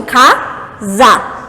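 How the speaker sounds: "casa" slowly split, "ca... za"; two separate emissions. Shown as a demonstration. Teacher explains syllables to students, "casa" in two beats. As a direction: draw out "ca", pause, then "za" for "casa". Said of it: "São duas emissões de voz".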